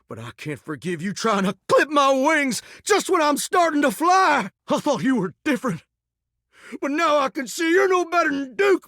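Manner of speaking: high-pitched, wounded rant; indignant outburst. Acted. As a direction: lash out, hurt that your talent is doubted. Hurt outburst after criticism.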